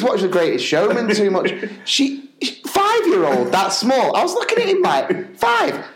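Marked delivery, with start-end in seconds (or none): 5.4-5.8 high, incredulous yelp; stunned disbelief at the number; shocked. Retold or imitated. Dad reacting when Santa calls his toddler five.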